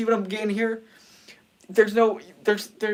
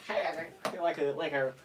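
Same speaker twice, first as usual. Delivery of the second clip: usual speaking voice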